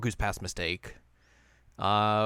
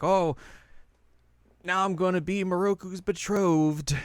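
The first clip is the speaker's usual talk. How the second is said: put-on swooning girl; 8 semitones higher than usual. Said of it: "and now she's like".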